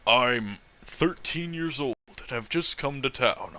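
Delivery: ordinary talk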